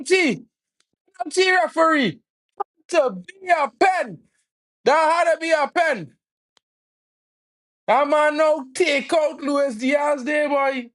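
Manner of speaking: outraged shout. Real, not acted. Fan yelling at the referee over a denied penalty, outraged shout. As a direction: scream for a penalty that wasn't given.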